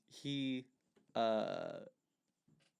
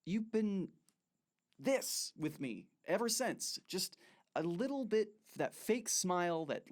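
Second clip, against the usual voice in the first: accusing sneer; 7 semitones higher than usual. Acted. Kenneth confronts Clark angrily.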